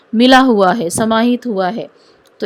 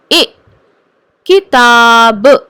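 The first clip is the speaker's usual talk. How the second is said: किताब stretched out very slowly, syllable by syllable; slow demo; 6 dB louder, much slower than usual. Shown as a demonstration. Teacher to students, spelling out the word very slowly. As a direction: draw out किताब for the class to hear each sound. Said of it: "जो भी उच्चारण कर रही हूँ उसको सुनो ध्यान से".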